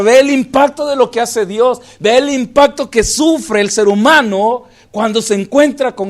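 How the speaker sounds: loud impassioned sermon voice; full-force pulpit preaching; fervent preaching. Acted. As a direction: preach it, pushing to the congregation. Preaching resumes.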